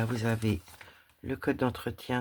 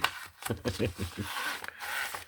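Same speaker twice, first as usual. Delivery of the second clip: long soft breathy laugh; a quiet self-mocking laugh; 5 dB quieter than usual. Real, not acted. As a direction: laugh at your own enthusiasm. I find everything beautiful.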